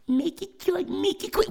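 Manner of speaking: high, soft mimicking squeak; squeaky sassy echo; mocking kid echo. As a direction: parrot her back under his breath. Baby hawk mocks his sister.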